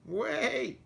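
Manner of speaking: high, loud call; crying out. Acted. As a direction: cry out after the tractor. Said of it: "cried Sheep".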